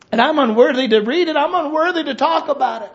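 high and loud, slowing on the last words; impassioned preaching. Real, not acted. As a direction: plead it with heavy emotion.

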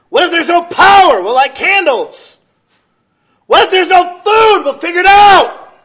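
loud, high shouted preaching. Acted. Pastor shouting defiance to the congregation, very loud. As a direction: shout the answers to the church.

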